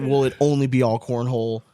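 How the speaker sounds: normal talking voice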